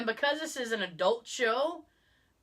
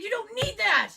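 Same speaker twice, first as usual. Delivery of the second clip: high-pitched, emphatic scolding; comically appalled dismissal; imitated insistence; 11 semitones higher, 4 dB louder than usual. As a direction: wave it off in mock outrage, voice up high. Retold emphatic refusal of iced tea.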